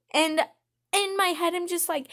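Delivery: ordinary talk, nothing marked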